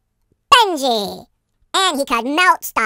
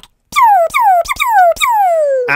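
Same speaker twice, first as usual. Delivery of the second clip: laser sound effect; 4 dB louder, slower than usual. Acted.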